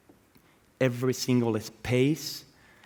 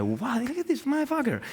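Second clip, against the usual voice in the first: much higher, awestruck exclamation; amazed admiration; 12 semitones higher than usual. Retold or imitated. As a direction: gush in delighted disbelief. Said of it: "It's like".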